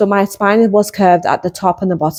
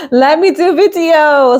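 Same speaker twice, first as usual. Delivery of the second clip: high laughing repeat, louder, stressing me; 9 semitones higher, 4 dB louder than usual. Shown as a demonstration. Vlogger laughing as she repeats her own line. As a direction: laugh it back at yourself, pitched up.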